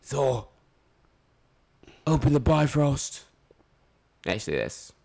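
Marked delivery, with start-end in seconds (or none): 0.0-3.2 Idris as Heimdall. Pretend. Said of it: "think of Thor".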